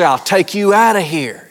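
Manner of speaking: a touch of a tough-guy mother; Mom's threat. Retold or imitated. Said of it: "as Mom used to say".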